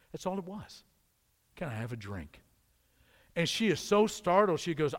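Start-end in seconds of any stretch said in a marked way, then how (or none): 1.5-2.4 soft, low, simple request; a hushed, humble Jesus asking for a drink; gentle Jesus voice. Acted. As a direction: ask it quietly, with tenderness. Jesus quoted asking for water.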